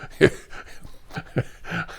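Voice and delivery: soft unvoiced chuckle; a quiet airy laugh; breathy laugh. Real, not acted. Catching himself laughing mid-rant, soft chuckle. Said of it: "I'm laughing".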